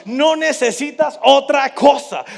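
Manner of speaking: loud, high, driving sermon cadence; full-force preaching. Acted. Preacher urging the congregation to trust God's word, shouting. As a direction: preach it to the rafters.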